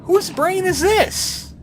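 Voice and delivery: high, slow, drawn-out question; dramatic wonder. Acted. Gamer entering a strange mind, high drawn-out wonder. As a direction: stretch it out like a spooky mystery.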